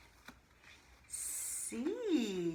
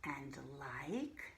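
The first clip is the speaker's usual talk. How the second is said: whisper; 5 semitones lower, 8 dB quieter than usual. Shown as a demonstration. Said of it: "there are words here we know".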